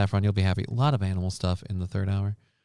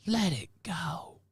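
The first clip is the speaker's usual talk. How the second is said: breathy whisper, slow; 7 semitones higher, 10 dB quieter, slower than usual. Pretend. Telling the caller to let it go, in a whisper. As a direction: breathe it out slowly.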